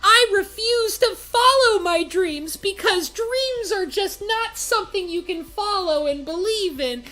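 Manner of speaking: put-on whiny crying kid. Reviewer mocking the kid character, in a high whiny crying voice. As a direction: whine it like a sulky kid close to tears. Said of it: "a kid character who's like".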